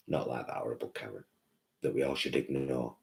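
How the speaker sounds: low, soft, quick mutter; muttered aside. Real, not acted. Vlogger muttering about the horrible Karen. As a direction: mutter it under your breath.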